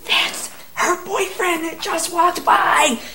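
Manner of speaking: high, slow, strained excitement; stretched high-pitched voice of disbelief; urgent astonished disclosure. Retold or imitated. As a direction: imitate someone freaking out without raising the volume. Retells the man's startled disclosure.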